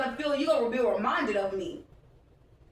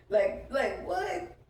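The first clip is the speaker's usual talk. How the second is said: usual speaking voice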